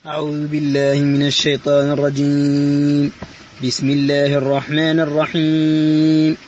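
ordinary talk

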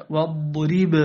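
"واضرب" with bounced final b; clear qalqala. Shown as a demonstration. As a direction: echo the last b of "واضرب". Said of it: "فجيء بقلقلتها واضحة". (قلقلة باء "واضرب" واضحة)

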